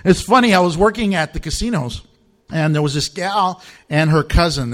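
usual speaking voice

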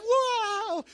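high, loud amazed wow; delighted wow. Acted. Jet ski rider in the joke, high delighted wow. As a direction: marvel out loud at the thrill. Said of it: "You're just like".

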